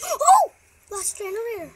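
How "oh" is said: sharp loud gasp of surprise; surprised gasp. Real, not acted. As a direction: gasp and shout it out. Spots last generator.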